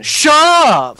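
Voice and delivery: loud, drawn-out shut up; exasperated shout. Real, not acted. Host cutting off the giggling co-hosts, exasperated shout. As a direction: yell it long to silence the gigglers. Interrupted by laughter, snaps back.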